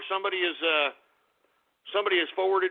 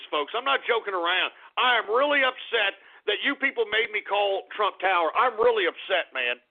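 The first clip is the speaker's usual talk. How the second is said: usual speaking voice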